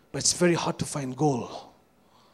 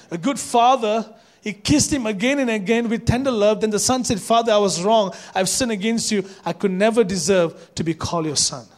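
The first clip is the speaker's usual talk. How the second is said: loud, raised scripture reading; 6 semitones higher, 7 dB louder than usual. Acted. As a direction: read the verse out loud to the church. Reads scripture aloud.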